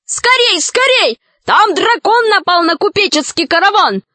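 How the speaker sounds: high, urgent alarm call; excited high-pitched shout; crying out. A narrator's reading. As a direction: burst in yelling the news. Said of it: "с криком".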